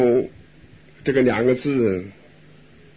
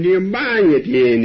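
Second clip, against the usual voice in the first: slow, higher, stretching the word for slow; drawn-out slow; 6 semitones higher, 4 dB louder, slower than usual. Shown as a demonstration. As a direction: draw it out to show unhurried chanting. Stretches the words advising slow chanting.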